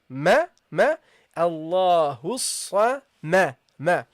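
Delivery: Allahus sa ma with a light m after the sad; light meem. Shown as a demonstration. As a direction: keep ma light despite the sad. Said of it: "then the meme".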